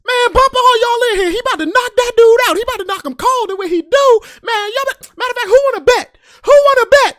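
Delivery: high, loud trash talk; hype shouting. Retold or imitated. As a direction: shout down the whole crowd. Trash talking crowd for his boy.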